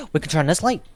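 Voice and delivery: high, quick delighted gasp; excited gasp. Real, not acted. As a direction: gasp and rush it out happily. Excited discovery of light.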